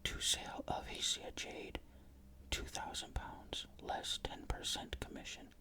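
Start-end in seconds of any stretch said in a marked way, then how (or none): none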